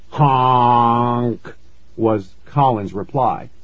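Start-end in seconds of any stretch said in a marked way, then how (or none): 0.1-1.4 long, drawn-out honk. A narrator's reading. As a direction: honk like a goose, holding the vowel. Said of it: "he began honking".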